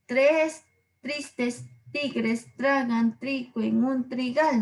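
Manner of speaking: slow, each "tr" crisp in "tristes tigres tragan trigo"; stressing the tr sound. Shown as a demonstration. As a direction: hit every "tr" slowly. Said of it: "voy repitiendo ... la tr".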